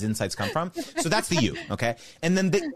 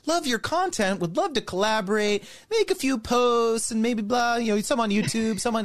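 higher, hammed-up brand-rep voice; mock brand pitch; 8 semitones higher than usual. Creator mimicking a brand offering a vague deal, higher mock voice. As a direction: play a brand pushing a vague collab. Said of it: "brands will reach out and be like".

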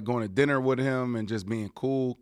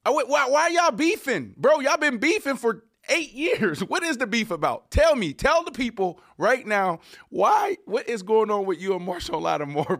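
excited; 7 semitones higher, 4 dB louder than usual. Real, not acted. Host presses about the beef.

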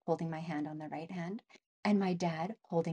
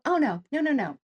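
emphatic denial; 6 semitones higher, 5 dB louder than usual. Real, not acted. Rejects the sweet imagined version.

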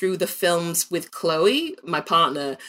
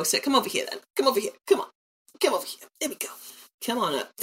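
coaxing; 5 semitones higher, 4 dB quieter, much faster than usual. Real, not acted. Coaxing the dog over.

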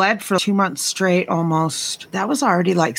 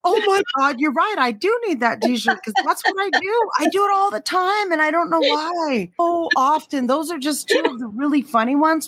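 high-pitched, giddy confession; amused, excited admission; 9 semitones higher than usual. Real, not acted. Teased friend admits she cracks jokes during sex, high and excited. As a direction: admit it helplessly, pitched well up.

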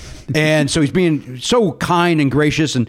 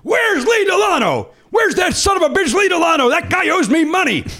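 mock bellow. Mel Brooks bursts onto the Charlie's Angels set yelling for Lee, hammed-up bellow. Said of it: "everybody hears".

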